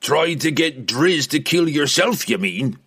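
lightly needling dwarf voice; pointed correction. A narrator's reading. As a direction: correct him with gruff scorn. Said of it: "Bruner corrected".

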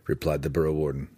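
usual speaking voice

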